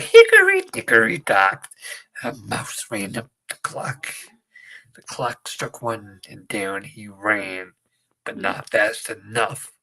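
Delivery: slow, eerie storytelling of a nursery rhyme; creepy nursery rhyme. Retold or imitated. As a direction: menace the listener through a children's rhyme. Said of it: "talk like that and just cut promos".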